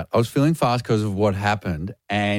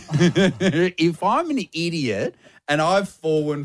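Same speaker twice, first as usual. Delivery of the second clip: laughing through the words, slow; can barely get it out for laughing; 4 semitones higher, slower than usual. Real, not acted. Host admitting he got pranked, laughing through it. Laughing at himself telling story.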